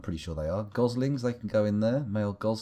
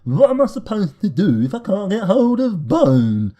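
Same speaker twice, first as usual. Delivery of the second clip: higher, louder, whining complaint; mock the moaning dwarves; whiny dwarf; 9 semitones higher, 10 dB louder, slower than usual. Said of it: "people have sat there like lemons going".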